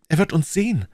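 put-on young woman fearing discovery; anxious protest. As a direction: object in alarm at being caught. Fear of being seen fleeing.

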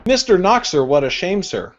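normal talking voice

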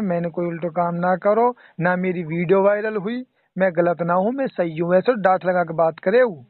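usual speaking voice

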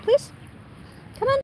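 ordinary talk

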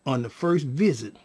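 ordinary talk

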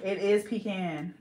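slow "pecan" as pee-CAN. Shown as a demonstration. Realising it from the spelling, "pecan" as slow pee-CAN. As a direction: stress IS, then "pecan" ending like tin can. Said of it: "P E C A N".

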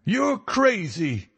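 high, slow, drawn-out American outburst; fierce, high-pitched American protest; fierce cry. A narrator's reading. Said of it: "he cried fiercely".

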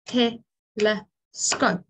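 Telescope said very slowly, split into three clapped beats; tel-e-scope, one beat per syllable; clapping syllables. Shown as a demonstration. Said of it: "clap the syllables in telescope like this".

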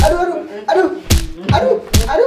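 high, loud cries of pain; pained yelling. Acted. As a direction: yelp as if really hit. Being beaten during fake shoot.

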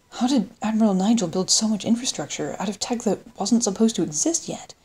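usual speaking voice

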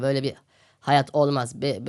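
ordinary talk, nothing marked

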